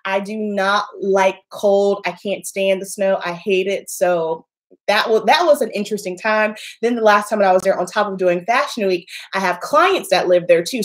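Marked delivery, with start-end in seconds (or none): none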